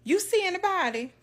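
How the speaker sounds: high, loud anxious question; grandma asking. Retold or imitated. Grandma asking her husband, high and loud. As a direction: ask her husband urgently. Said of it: "She's like".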